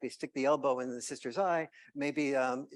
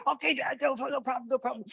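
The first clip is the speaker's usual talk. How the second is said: put-on much higher, louder kid voice; eager kids; 10 semitones higher, 8 dB louder than usual. Kids answering dad, much higher and louder. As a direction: play excited little kids.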